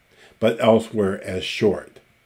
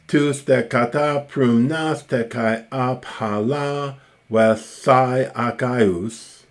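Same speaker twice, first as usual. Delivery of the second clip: somewhat higher, measured verse recitation; reciting Homer; 4 semitones higher than usual. Acted. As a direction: chant the Greek lines like epic poetry.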